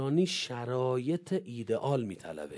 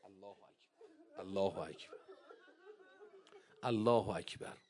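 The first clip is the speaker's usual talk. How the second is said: murmured takbir; 7 semitones lower, 10 dB quieter than usual. Real, not acted. Preacher murmurs takbir softly.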